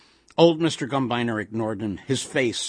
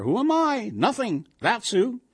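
high mock self-pity; triumphant Yiddish-inflected grumble; put-upon old husband; 11 semitones higher than usual. A narrator's reading. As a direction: answer your own questions, pitch leaping up.

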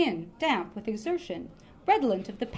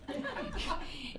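laughing; 12 semitones higher, 9 dB quieter than usual. Real, not acted. Reader cracks up mid-scene.

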